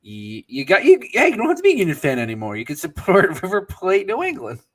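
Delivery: excited. Retold or imitated. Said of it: "I'm just like".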